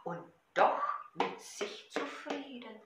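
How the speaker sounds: loud rhythmic poem recital over a drum; Gedichtvortrag. Shown as a demonstration. (laut und rhythmisch zur Trommel vorgetragen)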